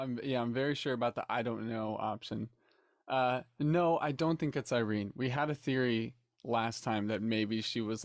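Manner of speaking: ordinary talk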